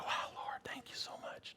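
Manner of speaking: hushed, whispered prayer of relief; whispered thanks. Retold or imitated. Father thanks God after daughter's surgery, whispered thanks. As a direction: whisper it in overwhelmed gratitude.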